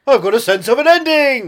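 high, very loud bellowed line; huge theatrical shout; booming actor impression. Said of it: "Trying to be Jim Broadbent".

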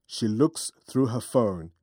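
usual speaking voice

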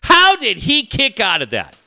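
loud, high-pitched outraged heckle; imitated yell. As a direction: yell it across a crowded bar. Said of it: "yells across the bar".

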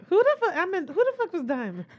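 high-pitched, incredulous demand; baffled outburst. Real, not acted. Host demands who this Diamond is, baffled.

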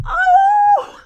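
very high, loud tearful "oh"; emotional squeal. Real, not acted. Fan relives Shadow leaving the woods, overjoyed wail. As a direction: cry out, overcome with joy.

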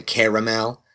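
caramel in three syllables, CARE-uh-mel; corrected caramel. Retold or imitated. Starbucks worker correcting the customer, caramel as CARE-uh-mel. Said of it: "they always correct me".

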